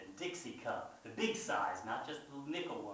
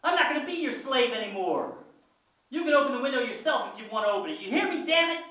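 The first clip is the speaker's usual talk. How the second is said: high, furious full-voiced yell; yell out loud; 11 semitones higher, 11 dB louder, faster than usual. A narrator's reading. Fed-up child defies brother within Mama's hearing, furious yell. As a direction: yell so the next room can hear. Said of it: "I yell out loud so even Mama can hear me".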